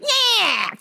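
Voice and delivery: loud, high snarling yowl; attacking cat screech. Retold or imitated. Cat biting owner during trim, screeching. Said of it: "this motherfucker starts attacking me and shit".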